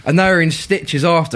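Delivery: ordinary talk, nothing marked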